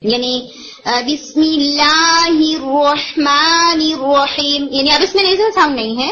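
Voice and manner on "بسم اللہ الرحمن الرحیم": بسم اللہ الرحمن الرحیم chanted slowly and lightly, no nasal; light recitation. Shown as a demonstration. Teacher modelling a light, unpressed sound. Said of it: "حلق کی طرف press کرنے لگ جاتے ہیں".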